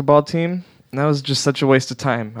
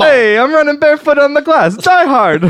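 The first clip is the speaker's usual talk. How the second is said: much higher, louder put-on movie star voice; Christopher Walken voice; 6 dB louder than usual. Explaining the joke to friends, a high loud Walken-style voice. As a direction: ham up a Walken-ish tough guy. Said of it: "What I did was".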